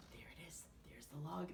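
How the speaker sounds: put-on hushed whispered aside to the kids; storyteller whisper. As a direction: whisper as if not to wake anyone.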